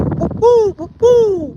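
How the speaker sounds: high hooting bird call, whoop woo; imitated bird call. Camper imitating the strange birds heard in the woods, high hooting call. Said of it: "the sound kept getting closer".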